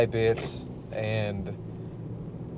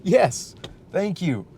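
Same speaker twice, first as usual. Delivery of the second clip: sarcastic thanks; 6 semitones higher, 4 dB louder, slower than usual. Retold or imitated. Mocking reply to the heckler.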